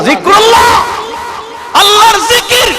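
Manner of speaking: very high sermon cry; preaching at full force. Acted. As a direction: ring it out to the hall.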